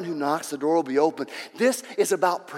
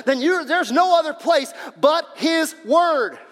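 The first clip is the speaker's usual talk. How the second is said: raised, high-pitched preaching voice; fervent preaching; 8 semitones higher, 6 dB louder than usual. Real, not acted. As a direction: drive it home to the congregation, loud and high. Preaches at full force.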